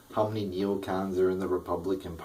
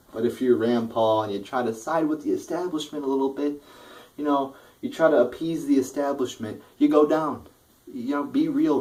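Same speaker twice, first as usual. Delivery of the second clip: heated rant; 6 semitones higher, 4 dB louder than usual. Real, not acted. Ranting about Rand Paul.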